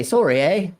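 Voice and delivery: "Sorry, eh?" with a lilting Canadian rise on "eh"; mock Canadian accent. Shown as a demonstration. Teaching chat how Canadians greet, in a light mock Canadian accent. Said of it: "Canadians say hello is".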